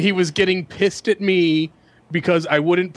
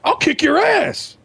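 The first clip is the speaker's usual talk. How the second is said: high, raised comic threat; mock threat; 13 semitones higher than usual. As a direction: threaten him over the phone. Kevin's threat on the phone.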